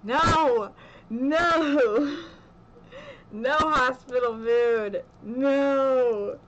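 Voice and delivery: loud, playful shouting of no at the food; mock loud refusal. To the viewers about hospital food, a hammed-up loud no. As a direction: push it away with a big hammed-up no.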